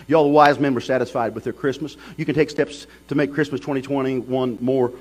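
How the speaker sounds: loud pulpit call; full preaching. Acted. Preacher urging the congregation to give the right gift, loudly.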